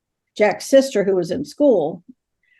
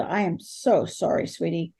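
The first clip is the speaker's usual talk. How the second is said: put-on lower, softer, consoling a small child; tender sympathy; 5 semitones lower, 7 dB quieter than usual. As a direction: sink into a gentle, quiet apology. Consoling a dead little girl.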